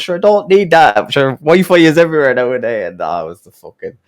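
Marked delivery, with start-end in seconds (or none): 0.0-2.9 high, loud retold brush-off; mock indignant refusal. Country customer turning down data from salesman, mock indignant. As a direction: imitate a customer waving away the offer. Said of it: "he must have ripped into the poor young lad".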